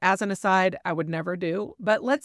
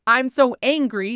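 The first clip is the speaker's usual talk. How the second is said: said louder and higher, owning the anger; a child naming anger in a raised voice; saying I'm angry; 5 semitones higher, 6 dB louder than usual. Shown as a demonstration. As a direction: declare the anger out loud, firmly. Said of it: "but you can say".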